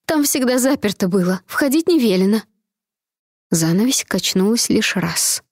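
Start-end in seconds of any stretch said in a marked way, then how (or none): 0.0-2.4 high, squealing excuse; shrill maid. Acted. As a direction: keep the panicky squeal. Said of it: "визгливо отозвалась служанка".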